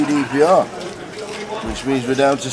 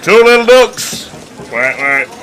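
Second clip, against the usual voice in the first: loud, slow seaside bingo call; bingo caller; 5 semitones higher, 6 dB louder, slower than usual. Retold or imitated. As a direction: belt it out like a Blackpool caller. Imitating a Blackpool bingo caller.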